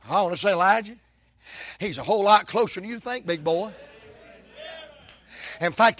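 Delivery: normal talking voice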